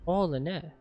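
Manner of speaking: ordinary talk, nothing marked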